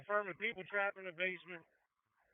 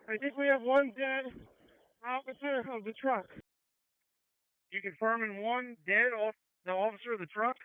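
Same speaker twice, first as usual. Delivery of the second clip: firefighter shouting through mask; 4 semitones higher, 7 dB louder than usual. Real, not acted. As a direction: shout the bad news over the radio. Reporting a dead officer.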